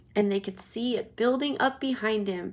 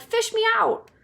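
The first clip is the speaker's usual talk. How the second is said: very high desperate plea; boy pleading very high; drowning boy; 12 semitones higher, 4 dB louder than usual. Acted. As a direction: beg to be pulled out. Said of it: "he cried".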